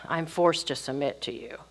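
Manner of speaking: sigh, then low, soft grudging resignation; resentful submission. Acted. Wife grudgingly giving in to her husband, resigned. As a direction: give in reluctantly, quiet and low. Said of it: "the wife would say ... That's not the attitude".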